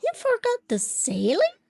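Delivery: high, squawking shocked teacher. A narrator's reading. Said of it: "My teacher squawks".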